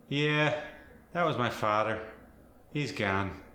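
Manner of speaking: Fargo accent, emphasized "ah" in father and gone. Shown as a demonstration. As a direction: lean on the Minnesotan AH. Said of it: "you really emphasize the ah".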